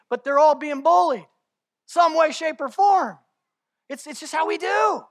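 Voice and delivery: impassioned sermon; full-force preaching. Real, not acted. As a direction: drive it home to the church.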